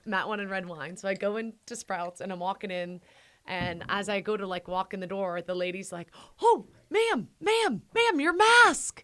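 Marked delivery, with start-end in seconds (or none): 6.4-9.0 high, loud, insistent mock store clerk calling out. Retelling to her husband, the clerk calling after her insistently.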